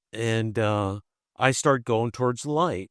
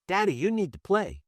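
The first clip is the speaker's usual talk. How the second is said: higher, lightly voiced little boy; three-year-old son; 8 semitones higher than usual. Son advising his bedridden dad, lightly voiced child. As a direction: imitate a small child telling Daddy. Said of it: "Three-year-old comes in".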